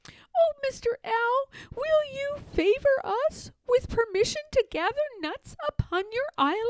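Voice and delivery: high, small, very polite squirrel voice. A narrator's reading. Little squirrels politely asking Owl Brown permission, high and sweet. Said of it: "bow and said politely".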